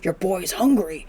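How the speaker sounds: usual speaking voice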